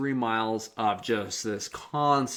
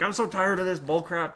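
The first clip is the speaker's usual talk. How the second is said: mock fed-up grumble, pitched up; grumpy inner rant; 6 semitones higher than usual. Coach grumbling to himself on the climb, mock fed-up. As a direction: vent like a sore, fed-up runner. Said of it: "like, angry".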